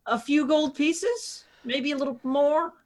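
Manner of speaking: haggling scorn. Acted. Rin haggling with the patron.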